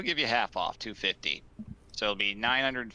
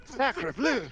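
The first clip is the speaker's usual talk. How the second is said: high French exclamation; French accent. Acted. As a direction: cry it out like a startled Frenchman. Old man reacts in French.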